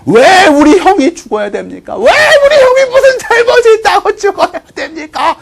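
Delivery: high, loud pleading outcry; impassioned protest. Acted. Younger brother rallying villagers for condemned brother, impassioned protest. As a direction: cry out to rouse the crowd, building.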